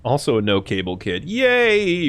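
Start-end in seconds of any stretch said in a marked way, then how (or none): 1.2-2.1 high, drawn-out yay; mock cheer. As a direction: cheer in a rising squeal.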